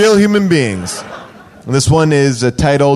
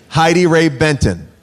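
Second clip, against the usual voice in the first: usual speaking voice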